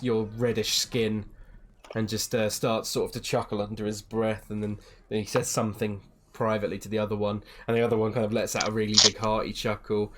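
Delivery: ordinary talk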